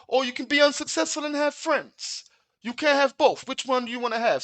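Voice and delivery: high, loud streetwise mob boss voice. Retold or imitated. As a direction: lecture him like a cocky mafia boss. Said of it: "And then he goes".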